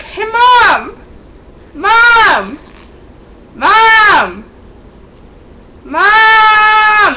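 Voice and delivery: very high, loud repeated call across the house. Retold or imitated. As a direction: holler for Mom again and again. Calling mom from the door.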